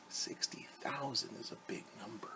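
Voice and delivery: hushed whisper, no voice. Real, not acted. Teacher whispering an aside to the listening children.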